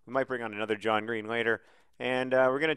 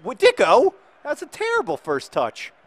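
high, loud disbelief; exasperated outburst; 12 semitones higher, 10 dB louder than usual. Real, not acted. Manager reacting to Dicko's terrible first touch, exasperated cry. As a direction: blurt it high, appalled at the mistake. Scolding player after bad touch.

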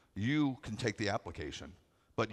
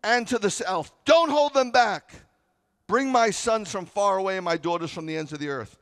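high, loud thundering scripture; booming the verse, denying it's yelling; proclaimed shout; 11 semitones higher, 12 dB louder than usual. Acted. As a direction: shout it like God commanding.

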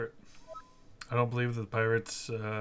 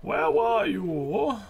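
higher, slow, stretched demand on "were"; professor voice; 10 semitones higher, 7 dB louder, much slower than usual. Pretend. Streamer redoing the professor's line. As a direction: call out like a stern professor. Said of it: "I think I could do his voice really well".

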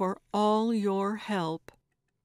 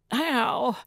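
higher, louder yelp of pain; pained cry; 5 semitones higher, 5 dB louder than usual. A narrator's reading. Gertrude feels the hammer's jolt, crying out. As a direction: cry out from sudden impact. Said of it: "she cried".

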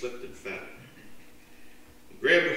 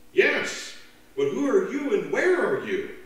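eager, anxious; 9 semitones higher, 6 dB louder than usual. Acted. Stranded man asks.

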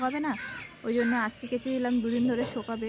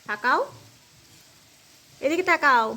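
high, loud raised voice; 5 semitones higher, 10 dB louder than usual. Real, not acted. Mother calling Irin to look at her, loud and high.

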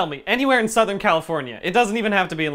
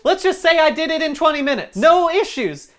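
high, loud victory claim; triumphant; 10 semitones higher, 7 dB louder than usual. Real, not acted. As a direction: crow about it proudly. Triumphant after being teased.

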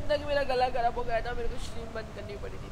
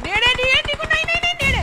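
much higher and louder yelling no, no; panicked shout; 11 dB louder than usual. Real, not acted. Gamer yells to her teammate in panic as enemies close in. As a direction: shriek it in panic as the enemy closes in.

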